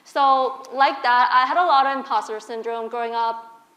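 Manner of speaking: usual speaking voice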